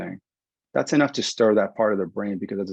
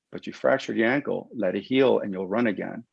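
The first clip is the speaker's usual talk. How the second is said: ordinary delivery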